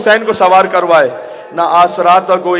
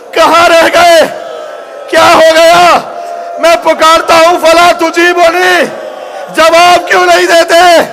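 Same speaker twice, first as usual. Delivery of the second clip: calling out; 9 semitones higher, slower than usual. Acted. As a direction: cry out to the fallen, drawn out. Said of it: "حسین نے آواز دی ہے".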